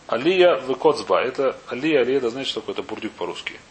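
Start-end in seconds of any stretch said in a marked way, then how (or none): none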